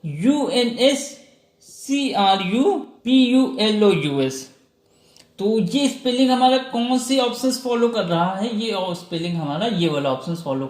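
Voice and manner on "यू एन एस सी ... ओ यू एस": letters after यू N S spelt out slowly and clearly; slow spelling. Shown as a demonstration. Teacher slowly spelling the word again for students. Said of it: "एक बार फिर से देखिए".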